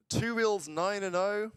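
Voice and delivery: high, drawn-out disbelief, rising at the end; incredulous surprise. Real, not acted. Streamer spotting a player's nine and oh stats, incredulous.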